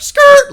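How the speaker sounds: high, loud screech of skidding tires; high-pitched skid noise. Retold or imitated. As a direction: mimic tires skidding in one sharp burst. Imitates tires screeching past deer.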